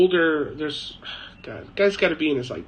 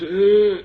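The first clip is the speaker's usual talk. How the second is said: higher, louder mock groan; imitated old man's groan; 6 semitones higher, 7 dB louder than usual. Shown as a demonstration. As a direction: groan like an old man faking a fall. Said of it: "yeah, like this".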